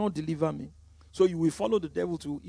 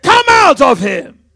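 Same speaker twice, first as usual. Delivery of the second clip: slow, much higher and far louder shout; commanding shout; 9 semitones higher, 19 dB louder, slower than usual. Retold or imitated. Preacher voicing Jesus driving out the spirit: booming shout. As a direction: drive the demon out with a roar.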